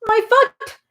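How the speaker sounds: high, loud yelp of pain; pained shout. Acted. Bee hit on the foot by the cane, loud high yelp. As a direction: cry out as the foot is struck. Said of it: "shouted the bee".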